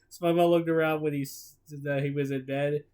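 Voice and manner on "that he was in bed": plain reading voice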